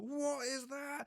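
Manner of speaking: high, loud startled question; startled outcry; shocked diners. Retold or imitated. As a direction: alarm at the spray. Diners reacting to blood.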